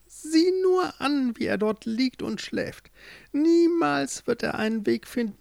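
put-on cackle-toned old witch sneering at her captive. A narrator's reading. Witch gloats over sleeping Joringel. (hohe, hämische Hexenstimme)

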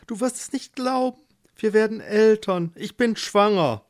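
high, elated wife; excited announcement. Acted. Marleen tells husband she is pregnant, excited. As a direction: share joyous news eagerly.

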